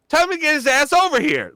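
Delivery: high, loud imitation of Dad calling out; Dad's voice. Imitating Dad yelling in the background, high and loud. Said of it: "listen to how he talks".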